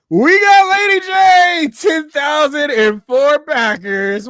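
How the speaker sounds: high, loud, slow triumphant call; excited announcement. Real, not acted. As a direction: announce it like a hype man. Celebrating a backer milestone.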